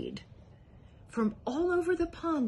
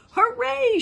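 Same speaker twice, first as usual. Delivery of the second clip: high, loud cheer; triumphant shout; 9 dB louder than usual. A narrator's reading. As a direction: crow with triumph. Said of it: "shouted Yertle".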